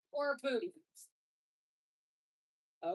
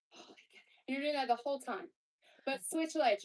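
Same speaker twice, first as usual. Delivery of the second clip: normal talking voice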